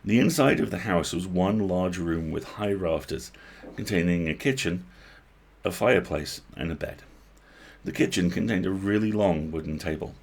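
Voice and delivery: usual speaking voice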